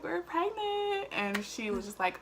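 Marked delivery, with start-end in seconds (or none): 0.0-1.1 higher, softer and slow, near tears; telling mom the news, voice full of tears; tearful announcement. Retold or imitated. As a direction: choke up with joy. Handing mom the gift bag.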